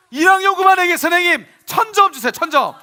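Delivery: high, loud cheer for a class; excited announcement. Real, not acted. Teacher praising first-graders, loud and excited. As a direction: cheer them on with a big reward. Rewarding children loudly.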